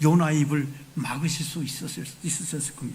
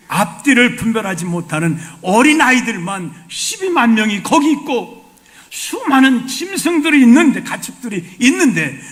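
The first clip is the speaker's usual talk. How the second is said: loud, high, impassioned rebuke; God preaching; 6 semitones higher, 9 dB louder than usual. Acted. God loudly pleading with Jonah about the city of Nineveh. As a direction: pour it out with full force.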